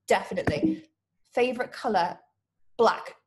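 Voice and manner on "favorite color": ordinary talk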